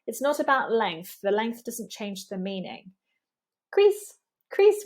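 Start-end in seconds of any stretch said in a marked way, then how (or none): 3.7-4.8 "crease" with a tense ee kept in proportion; high-pitched "crease", vowel not dragged; long E, not overstretched. Shown as a demonstration. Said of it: "We don't want to overstretch that E".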